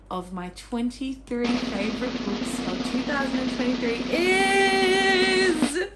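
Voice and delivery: suspenseful build to a long, high, loud final word; drum-roll announcement. Pretend. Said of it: "give it a drum roll".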